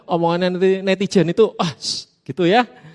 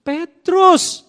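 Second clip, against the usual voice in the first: higher and louder, announce the name Petrus; exclaimed answer; 10 semitones higher, 8 dB louder than usual. Shown as a demonstration. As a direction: call out Petrus like a big reveal. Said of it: "jadi apa?".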